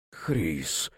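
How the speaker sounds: breathy whispered name. A narrator's reading. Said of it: "прошептал Волхв".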